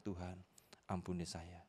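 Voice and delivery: hushed, low plea for forgiveness; retold prayer. Father repenting to God that day, low and quiet. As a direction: softly ask God's pardon. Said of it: "hari itu saya berdoa".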